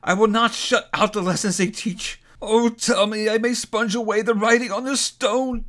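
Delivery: high-pitched, impassioned vow; agonized entreaty. A narrator's reading. Scrooge promising the spirit he will change, agonized entreaty. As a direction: swear it desperately, pleading for mercy. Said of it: "In his agony".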